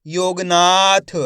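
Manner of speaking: योगनाथ slowly, with ना held long; drawn-out blended word. Shown as a demonstration. Teacher showing the letters combined, योगनाथ slowly drawn out. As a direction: stretch योगनाथ as one joined word. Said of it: "तो मिलके बन जाएगा".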